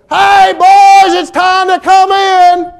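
high, loud, drawn-out call from the porch; mom calling out. Retold or imitated. Mother on the porch calling her boys in, loud high sing-song call. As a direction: call the boys home like a mother across the yard. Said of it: "she stood out on the porch and she yelled".

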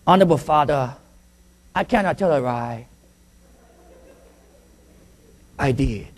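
subdued boy in a put-on Chinese accent; mock Chinese accent. Little boy confessing to his father, mock Chinese accent. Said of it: "this little Chinese boy".

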